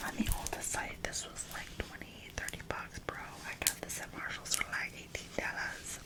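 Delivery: ASMR whisper. Real, not acted.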